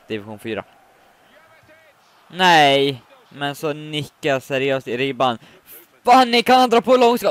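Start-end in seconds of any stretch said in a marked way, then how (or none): 6.0-7.3 high, loud hopeful shout; excited call. Real, not acted. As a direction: call it out as the shot comes. Hoping for a long shot.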